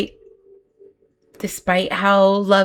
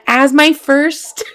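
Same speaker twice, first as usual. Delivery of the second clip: "as my first" said higher, louder and slow, rising; excited emphasis; 7 semitones higher, 8 dB louder, slower than usual. Real, not acted. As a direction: stretch out "as my first", building excitement.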